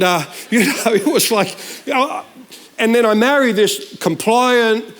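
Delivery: ordinary talk, nothing marked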